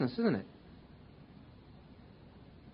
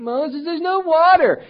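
much higher, louder, slow drawn-out whine; whining to Moses; 12 semitones higher, 7 dB louder, slower than usual. Retold or imitated. Israelites whining at Moses in the desert, high and loud. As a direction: wail the complaint up at Moses. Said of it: "And they start whining".

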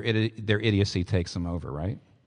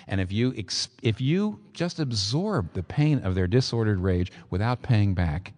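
ordinary talk, nothing marked

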